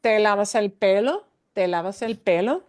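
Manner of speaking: pelo rises at the end of each ¿Te lavas el pelo?; rising question tune on pelo in ¿Te lavas el pelo?. Shown as a demonstration. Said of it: "it's gotta sound like this".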